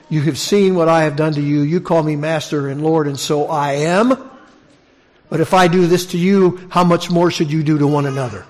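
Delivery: preaching Jesus' words. Acted. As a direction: proclaim the master's challenge from the pulpit.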